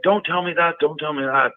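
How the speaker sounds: high, pleading imitation of a scared wife. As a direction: beg him to stop in a mock frightened voice. Wife scared, refusing to hear.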